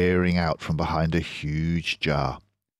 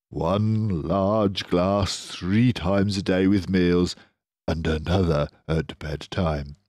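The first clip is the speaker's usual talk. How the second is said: slowish, knowing Badger voice; lightly voiced Badger. Badger explains cider is medicine for badgers. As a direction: say it like sage advice.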